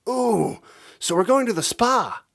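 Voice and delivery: high, loud thrilled squeal; bouncing with glee. A narrator's reading. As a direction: squeal with excitement. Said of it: "Angel squealed".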